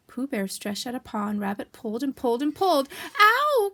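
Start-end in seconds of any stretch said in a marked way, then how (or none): 3.2-3.7 very high, loud yelp of pain; sharp acted ow; cried out. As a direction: yelp as if yanked. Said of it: "cried Pooh".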